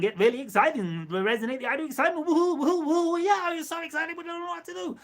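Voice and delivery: high, whooping mock thrill; pretend excitement. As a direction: rush up into giddy woohoos, then squeal on high. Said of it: "experiment with the idea of excitement".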